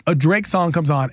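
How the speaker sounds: ordinary delivery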